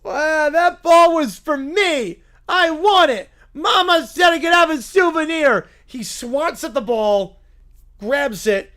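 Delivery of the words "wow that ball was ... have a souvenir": high, loud, slow bratty wail; loud whining kid. Acted. Fan wailing at the crowd over the ball. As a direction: whine like a spoiled child denied a toy. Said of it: "whining loudly".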